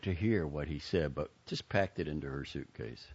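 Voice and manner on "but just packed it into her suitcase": ordinary delivery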